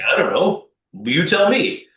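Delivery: mock helpless client, voice jumping up; clueless client. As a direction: toss it back like a lost client. Client's clueless reply, acted quote.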